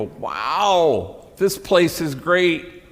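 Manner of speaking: slow, drawn-out awed wow; gushing tourist. Retold or imitated. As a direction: ham up an impressed tourist. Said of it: "They go".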